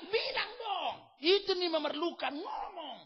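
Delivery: high shout fading down; God insisting. Acted. God voiced challenging believer. (Tuhan mendesak dengan suara tinggi)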